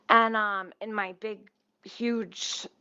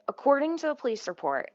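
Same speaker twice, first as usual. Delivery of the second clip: usual speaking voice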